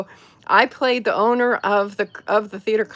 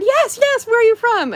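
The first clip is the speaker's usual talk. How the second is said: much higher, eager British-fan voice; imitating an eager woman, much higher; excited woman; 6 dB louder than usual.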